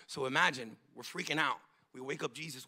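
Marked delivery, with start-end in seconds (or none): none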